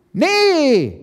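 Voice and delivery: high, loud, drawn-out outcry; agitated objection. A narrator's reading. Elke objects at the summit, agitated. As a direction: object in a high, worked-up yelp. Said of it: "entgegnete Elke erregt".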